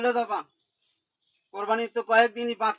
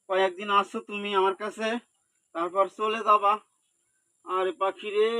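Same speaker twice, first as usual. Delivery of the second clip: ordinary talk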